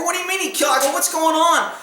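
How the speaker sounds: high, bewildered demand; retold alarmed questioning. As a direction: press for answers, pitched well up. Questioning the upset woman, retold.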